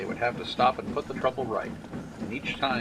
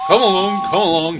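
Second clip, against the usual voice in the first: louder, higher puffing call; 8 semitones higher, 11 dB louder than usual. Acted. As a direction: chug the trucks along. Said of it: "he puffed".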